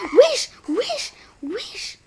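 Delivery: loud, high yelp of surprise; sudden startled cry at the game; startled shout. Real, not acted. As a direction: yell it out, caught off guard.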